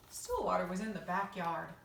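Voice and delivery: ordinary delivery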